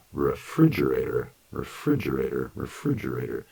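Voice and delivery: "refrigerator" with a dj sound, each repeat fading; natural long form. Shown as a demonstration. Teacher repeating "refrigerator" for students to copy. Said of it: "If I say it long".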